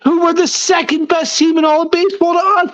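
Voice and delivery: arguing his point, voice climbing high; heated argument. Real, not acted. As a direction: pitch up, worked-up insistence.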